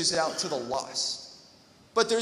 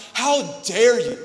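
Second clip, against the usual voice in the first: angry outburst; 4 semitones higher, 6 dB louder than usual. Retold or imitated. Older son yelling at father.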